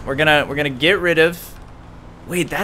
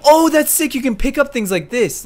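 high, thrilled exclamation; wowed, pitched-up excitement; delighted discovery; 10 semitones higher than usual. Real, not acted. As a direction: marvel at the new power in a high voice. Discovers new game power.

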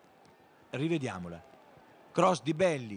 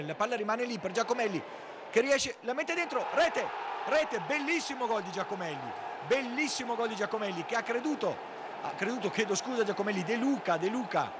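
high excited goal call; breathless italian goal commentary; 9 semitones higher than usual. Real, not acted. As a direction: yell the goal, rising fast.

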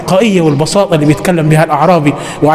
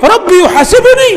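much higher, startled disbelief; astonished high-pitched question; shocked question; 13 semitones higher, 3 dB louder than usual. Retold or imitated. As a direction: gasp it out in disbelief. Bedouin shocked at being judged.

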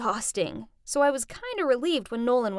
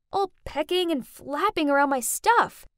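ordinary delivery

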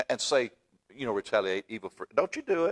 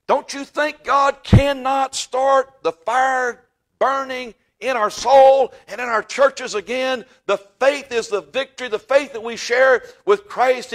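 high, loud, slow hellfire preaching; preaching at full force; 12 semitones higher, 10 dB louder, slower than usual. Real, not acted. Preacher rousing his congregation, loud soaring preaching. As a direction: lift the church with a soaring shout.

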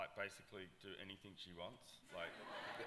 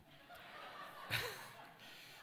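a real high burst of laughter. Real, not acted. Laughing at the festival story, a high real laugh.

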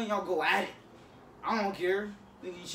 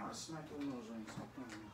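low muttered threat; 7 semitones lower, 13 dB quieter than usual. Real, not acted. Mock threat to brother.

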